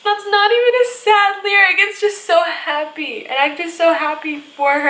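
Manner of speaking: high, tearful voice with little laughs; happy crying. Real, not acted. Fan overcome by a happy love lyric, crying. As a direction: laugh through tears, voice riding high. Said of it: "I don't know why I'm crying".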